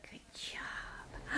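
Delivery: soft whispered praise. Real, not acted. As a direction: whisper it so you don't distract.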